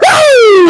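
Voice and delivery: long, high, loud wrestler's whoop; drawn-out high holler; Ric Flair woo. Acted. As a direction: let out a piercing "woo". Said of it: "gave you the Ric Flair woo".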